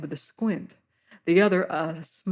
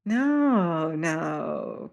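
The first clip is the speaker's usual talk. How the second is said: higher, slow smiling sailor; oily friendliness, drawn out; smiling high-voiced fat seaman; high-pitched voice. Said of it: "quoth he".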